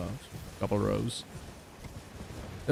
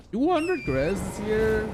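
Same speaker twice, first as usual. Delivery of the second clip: disbelief; 11 semitones higher, slower than usual. Real, not acted. Disbelief at credit load.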